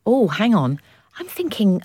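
usual speaking voice

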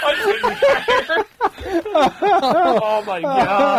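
slow, high words through laughter; laughing helplessly. Real, not acted. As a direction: struggle to talk while laughing. Helpless laughing over the story.

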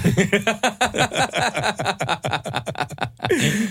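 breaking into long high laughter; helpless laughter at yourself. Real, not acted. Punchline of own story. (pitkä nauru)

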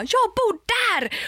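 hammed-up outraged neighbour, drawn out; mock indignant exclaim. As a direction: fling the words out pointing through the door.

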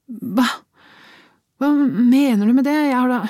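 high, loud stunned protest that breaks off; wife cut to the quick, voice jumping high; shocked disbelief. Acted. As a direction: caught off guard, stammering. Wife stunned by his remark.

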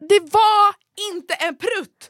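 high, loud and slow insistence; emphatic denial. Retold or imitated. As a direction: drag it out, insisting. Insisting it wasn't a fart.

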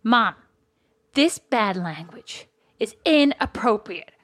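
slow, measured telling-off of Mom; mock-solemn child's objection, word by word; imitating a child's slow reproach; disapproving child. Retold child scolding parent over poem.

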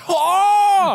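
very high, hammed-up outburst; mock amazed crowd. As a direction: exclaim like a wildly impressed audience. Acting out an amazed audience reaction.

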